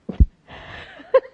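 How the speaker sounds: a burst of loud breathy laughter. Real, not acted. Laughs at own joke.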